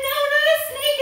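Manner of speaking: high, loud mock panic; play-acted alarm; scared frog. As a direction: yelp it as a frightened little frog. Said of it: "The frog was scared".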